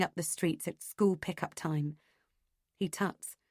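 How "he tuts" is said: plain reading voice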